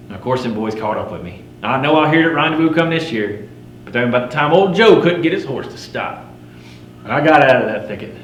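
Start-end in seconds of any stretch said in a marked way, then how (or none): none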